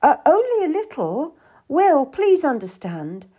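high, pleading appeasement; pacifying. A narrator's reading. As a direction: plead gently to pacify him. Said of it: "I tried to pacify him".

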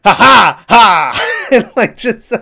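high, loud, slow mocking cackle; mock villain laugh. Pacino laughing at the gangster on the subway, loud mock cackle. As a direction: cackle like the devil mocking a stranger.

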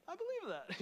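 high, laughing disbelief; amused squeal through laughter; laughing at oneself. Acted. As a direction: crack up at your own belief. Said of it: "This really is fun. It's fun to laugh at yourself".

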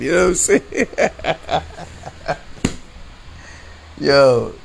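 high-pitched talk breaking into real laughter; laughing. Real, not acted. Storyteller amused by his own frustration, laughing. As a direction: crack up between the words.

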